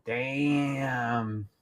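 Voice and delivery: broad rural Queensland drawl on damn; Australian Bogan Damn; Bogan accent. Shown as a demonstration. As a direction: lay on a thick Bogan accent. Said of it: "I have a deep Bogan accent".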